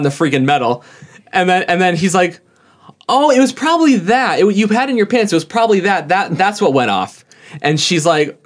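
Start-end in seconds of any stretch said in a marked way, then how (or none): 3.1-7.2 high-pitched mock discovery, easing down; feigned realisation. Employee playing dumb to a suspected shoplifter, feigned realisation. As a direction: act as if it just dawned on you.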